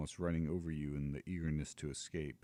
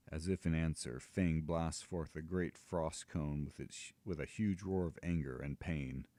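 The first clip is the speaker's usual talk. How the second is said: ordinary delivery, nothing marked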